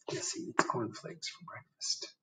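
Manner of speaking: soft unvoiced confiding whisper. Real, not acted. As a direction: hush your voice to a secret whisper.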